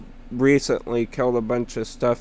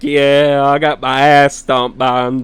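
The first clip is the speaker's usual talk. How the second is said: mock loud admission; 2 semitones higher, 7 dB louder than usual. Loud self-mocking retort.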